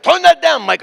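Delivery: loud, high-pitched mock shout; imitated angry yell. Cab driver to boombox-carrying passenger, angry yell. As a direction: yell over blaring music like a fed-up driver.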